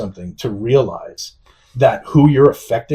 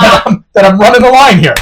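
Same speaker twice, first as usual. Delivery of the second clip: laughing protest; 10 semitones higher, 9 dB louder than usual. Retold or imitated. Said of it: "I'm very sarcastic too".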